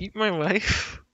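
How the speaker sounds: drawn out, slow and deadpan; slow teasing. Pretend. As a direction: stretch it out slowly to tease. Slow teasing joke line.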